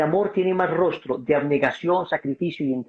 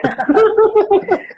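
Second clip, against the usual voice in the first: high burst of laughter; real loud laugh at his own story; 8 dB louder than usual. Real, not acted. Speaker laughing loudly while telling the story.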